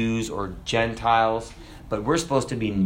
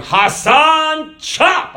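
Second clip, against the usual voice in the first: very high, loud cartoon guard bellowing Hassan, chop; hammed-up cartoon brute barking the order; 9 dB louder, much slower than usual. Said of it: "he was walking around going".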